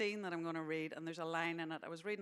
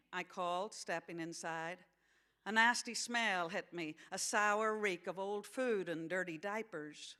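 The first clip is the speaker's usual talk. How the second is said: story narration in a put-on American accent; American accent reading; 3 dB louder, slower than usual. Author reading a novel to an audience, American accent. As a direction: read it aloud as an American. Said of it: "gonna read this in an American accent".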